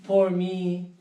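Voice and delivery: Poor me wailed out loud; loud self-pity. Retold or imitated. Watching diners eat, wailing loud self-pity. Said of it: "I would make the face like".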